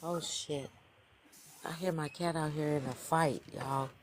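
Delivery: very soft, low aside; quiet, low voice while listening out; hushed concern. Real, not acted. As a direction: say it under your breath. Listening to cat fight outside.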